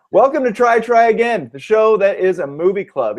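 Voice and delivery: podcast host intro. Acted. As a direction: announce the show with energy. Redoes the show intro.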